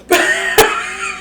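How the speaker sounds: high laugh bursting out; real high laughter. Real, not acted. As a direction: laugh in disbelief at the claim. Laughing at [name]'s claim.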